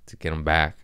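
normal talking voice

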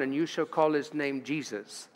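ordinary delivery

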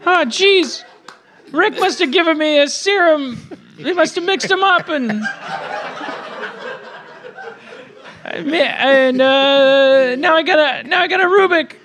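put-on high, nervous teen boy voice; Morty voice. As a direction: stammer high like a nervous kid. Morty voice held.

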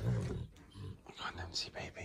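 slow whispered coax. Real, not acted. Nudging the dog near the sleeping baby, slow whisper. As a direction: coax it in a hush.

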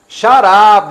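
fuerte y agudo, cortante; suéltalo con fuerza; shut up gritado. Shown as a demonstration. Ejemplo al juez. (grito de "shut up")

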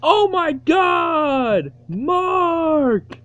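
high, drawn-out mock wail of Mark's name; hammed-up freak-out voice; imitating a freak-out. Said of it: "Purple Pin's freaking out".

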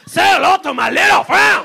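high, loud shouted bad Albanian accent; mock Albanian accent. Albanian mafia man with a machine gun, shouting in mock Albanian accent. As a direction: bellow it in a dubious Albanian gangster accent. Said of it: "Is that the Albanian accent?".

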